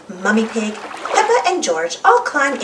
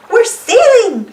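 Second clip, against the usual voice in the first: high, loud and slow; shout; 11 semitones higher, 7 dB louder, slower than usual. Acted. Danny shouts proudly to the others, high and loud. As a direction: yell it across the lake. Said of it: "shouts Danny".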